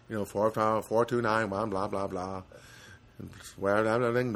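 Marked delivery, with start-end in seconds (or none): none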